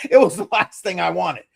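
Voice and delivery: laughing through the words. Real, not acted. Laughing while speaking.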